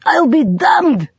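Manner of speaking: grudging admiration drawn out; amused disbelief. A narrator's reading. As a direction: savour the surprise. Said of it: "he said again".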